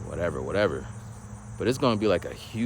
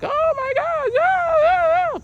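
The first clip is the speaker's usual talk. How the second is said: squeaky, much higher and louder mock freakout; mock panic; 6 dB louder, slower than usual. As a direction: play someone losing it in panic. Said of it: "it would be all panic time".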